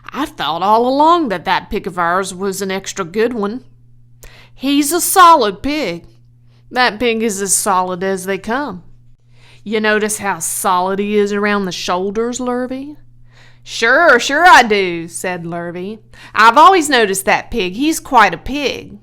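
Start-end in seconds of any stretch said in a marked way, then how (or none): 0.1-13.0 louder, self-important farmer boasting. Acted. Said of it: "he said in an important voice". 13.6-15.1 loud, eager hired man agreeing fast; keen to please the boss, loud; eager farmhand. Acted. 16.3-19.0 loud, eager hired man agreeing fast; keen to please the boss, loud; eager farmhand. Acted.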